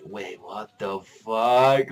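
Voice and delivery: slow, drawn-out stunned question; stunned disbelief. Retold or imitated. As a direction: let the shock sink in slowly. Shock at the reveal.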